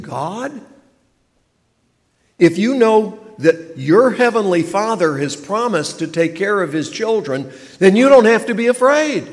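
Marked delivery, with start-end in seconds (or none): 2.4-9.3 much higher and louder, building to a laugh; full-force preaching. Acted. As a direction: proclaim it with rising joy.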